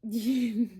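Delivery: loud laugh into a word; real laugh spilling into speech. Real, not acted. Reader caught by energy coming through, laughing. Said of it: "I'm laughing".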